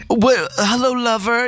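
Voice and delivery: high, sultry mock seduction; breathy vintage purr. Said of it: "they'd be like".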